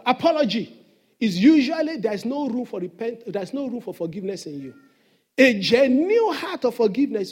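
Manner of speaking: loud, rising preacher's call to the church; full-force preaching. Acted. Pastor urging his church to forgive, preaching loud. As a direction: drive the point home to the congregation.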